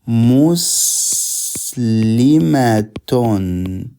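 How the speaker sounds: Muslimatun stretched out very slowly, syllable by syllable; very slow model word. Shown as a demonstration. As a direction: draw out Muslimatun for the class to hear each sound. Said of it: "and you say".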